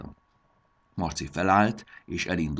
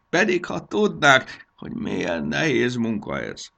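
loud, put-upon gnome complaint; grumbling gnome; 5 semitones higher, 8 dB louder than usual. Acted. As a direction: lament the chores, swelling louder. Gnome complains about his work. (zsörtölődő, panaszos, hangos manóhang)

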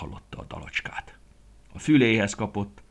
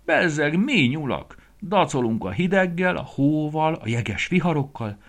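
higher, louder bragging; proud boasting; 9 semitones higher, 6 dB louder than usual. Acted. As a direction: puff up and boast about winter.

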